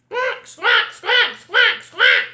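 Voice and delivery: loud, high repeated squawks; piercing run of bird calls. A narrator's reading. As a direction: squawk loudly like a cartoon bird. Bird squawks before answering Franklin.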